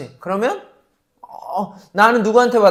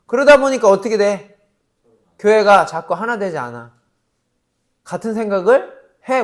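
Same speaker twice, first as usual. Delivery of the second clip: ordinary delivery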